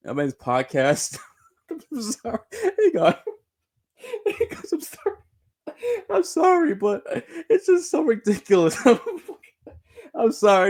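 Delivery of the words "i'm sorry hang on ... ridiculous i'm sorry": very high, squeaky words through a real laughing fit; apologizing while in stitches; helpless laughter. Real, not acted. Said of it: "I shouldn't be laughing like this".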